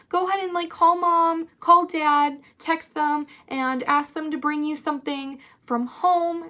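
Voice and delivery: high-pitched coaxing imitation; put-on high helper voice; helpful staffer. Imitates staffer helping upset student.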